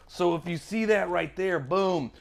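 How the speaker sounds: normal talking voice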